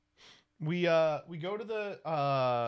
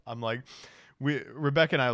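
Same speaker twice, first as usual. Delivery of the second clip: normal talking voice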